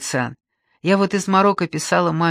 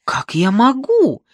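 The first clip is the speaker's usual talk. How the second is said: higher, louder, slower alarmed cry; frightened exclamation; 7 semitones higher, 6 dB louder, slower than usual. Acted. Grandmother answering frightened granddaughter, alarmed higher cry. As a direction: cry out, a touch scared and helpless. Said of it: "воскликнула тоже испуганная Елена Павловна".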